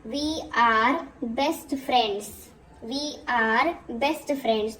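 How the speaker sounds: very slow, each word set apart; slow dictation. Shown as a demonstration. Teacher reading an example sentence slowly to the class. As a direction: read it out slowly for learners to copy. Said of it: "जैसे कि मैं बोलूँगी sentences का English में".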